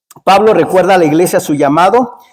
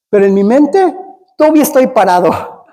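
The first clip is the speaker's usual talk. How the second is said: higher-pitched, lightly voiced stubborn boy; defiant child; 4 semitones higher than usual. Seated Pepito defies mamá in his head, imitated defiance. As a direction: imitate a boy holding out after punishment. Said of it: "Y dice".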